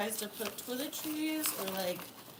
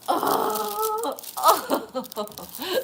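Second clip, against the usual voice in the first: high gasp and loud oh; gasp, then burst out delighted; surprised; 9 semitones higher, 9 dB louder than usual. Real, not acted. Finding the gift she wanted, loud happy surprise.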